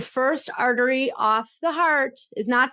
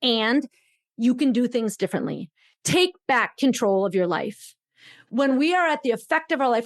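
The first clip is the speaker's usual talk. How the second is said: ordinary talk, nothing marked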